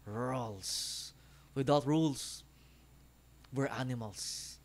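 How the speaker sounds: a lightly voiced villain, slow and measured; lightly imitating a menacing film villain; Marquis de Gramont impression. Vlogger imitating the villain's voice for viewers, slow and measured. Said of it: "Gagayahin natin yung boses".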